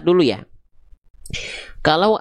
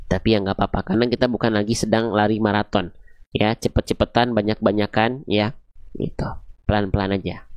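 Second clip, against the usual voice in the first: 1 reassuring softly; 7 semitones lower, 3 dB quieter than usual. Real, not acted. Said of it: "reassuring students to go slow".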